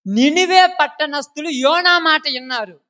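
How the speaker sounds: loud, high, slow declamation; preacher's proclamation. Acted. Preacher sums up Nineveh's response for listeners, proclaiming loudly. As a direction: lift it high and draw it out.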